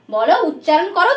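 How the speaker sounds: ordinary talk, nothing marked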